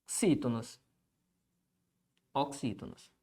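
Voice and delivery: low, soft dictation to oneself; writing-along murmur. Real, not acted. Teacher writing the term for students, low absent murmur. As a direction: say it quietly as you write it.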